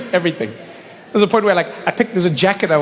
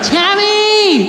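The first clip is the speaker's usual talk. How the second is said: very high mock party shriek. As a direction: call your friend in a high squeal. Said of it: "her getting into the game".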